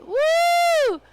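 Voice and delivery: high, loud whoop of joy; delighted whoop. Real, not acted. Crafter cheering a perfect bevel on camera, whooping loud.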